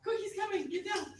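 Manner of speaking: loud, high-pitched mock alarm; urgent warning. Warning the others that he's coming, urgent. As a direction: sound the alarm in a hurry.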